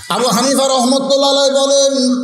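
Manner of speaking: high-pitched, ringing sermon delivery; high preacher's call; preaching at full force. Real, not acted. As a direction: pitch it high, leading up to scripture. Preacher builds up to scripture.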